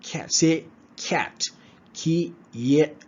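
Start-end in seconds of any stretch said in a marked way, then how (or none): 1.9-3.0 whispered "Ki yet" with a y glide; y-glide cat. Shown as a demonstration. Teacher showing how cat begins. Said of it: "start with a bit of y sound".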